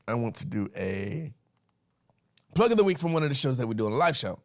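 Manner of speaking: normal talking voice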